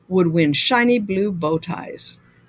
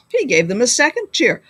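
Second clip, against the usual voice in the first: plain reading voice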